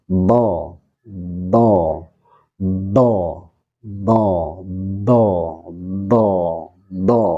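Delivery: "do" stretched along the tongue edge; lengthened dhad. Shown as a demonstration. Teacher drilling istitolah from the tongue's edge, prolonged "do". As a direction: prolong the voiced sound in each "do".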